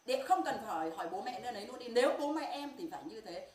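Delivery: loud, high, furious accusation; angry outburst. Real, not acted. Grieving mother angrily accusing girlfriend.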